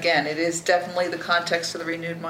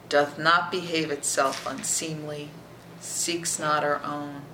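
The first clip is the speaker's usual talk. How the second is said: ordinary delivery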